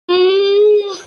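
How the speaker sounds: a loud grunt of approval; loud satisfied hmm. Acted. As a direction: grunt like a pleased grandma.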